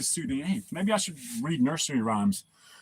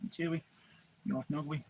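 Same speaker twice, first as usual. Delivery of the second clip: cooing softly; 11 dB quieter than usual. Real, not acted. Speaking to the puppies, soft cooing. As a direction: coo it gently like to a pet.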